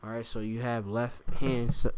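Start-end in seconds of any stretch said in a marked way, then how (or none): none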